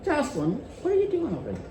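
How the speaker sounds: fast, high, soft question; light playful inquiry; sweet curious tone. Shown as a demonstration. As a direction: ask it quickly and kindly. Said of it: "What has changed? The tone".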